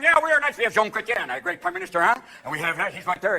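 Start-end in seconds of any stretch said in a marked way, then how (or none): 0.0-2.1 high-pitched boastful Canadian; hammed-up Canadian pride; proud Canadians. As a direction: brag cheerfully in a raised voice. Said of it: "They're all up there going".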